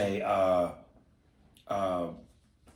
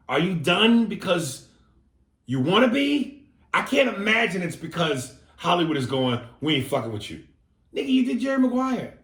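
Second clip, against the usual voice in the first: worked-up rant; 8 semitones higher, 5 dB louder than usual. Real, not acted. Exasperated rant about Cameron Crowe.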